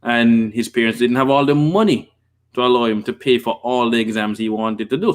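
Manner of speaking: normal talking voice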